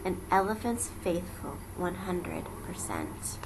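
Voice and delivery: soft whispered promise; whispered vow. Acted. Lonely Horton repeating his vow, whispered. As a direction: breathe the promise to himself.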